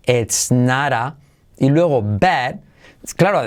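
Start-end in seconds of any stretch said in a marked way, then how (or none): 0.1-1.2 slow "ets nara" for "it's not a"; like Ainara with E. Shown as a demonstration. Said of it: "pero con E". ("it's not a" como "Ainara", pero con E)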